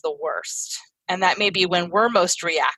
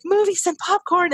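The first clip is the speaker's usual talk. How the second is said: put-on excited child; 10 semitones higher than usual. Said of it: "he was like".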